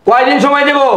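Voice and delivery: exasperated, escalating challenge; angry outburst. Acted. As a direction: throw the question back heatedly. Creditor repeats question, voice raised.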